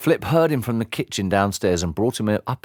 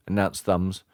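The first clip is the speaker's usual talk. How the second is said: ordinary delivery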